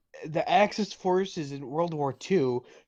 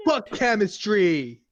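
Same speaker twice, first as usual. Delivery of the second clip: slow, louder frustrated outburst; frustrated yell; 5 semitones higher, 5 dB louder, slower than usual. Real, not acted. Friend failing to recall a chemistry term, frustrated yell. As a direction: give up on chemistry loudly. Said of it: "I don't fucking know".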